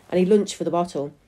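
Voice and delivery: plain reading voice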